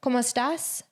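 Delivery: "¿Cómo estás?" lifted up at the end; Spanish "¿Cómo estás?" with a rising close; rising question tune. Shown as a demonstration. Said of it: "you give it a little up".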